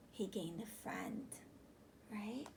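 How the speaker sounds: soft unvoiced reveal; whisper. Teacher whispering to the boys and girls. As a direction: whisper the happy answer.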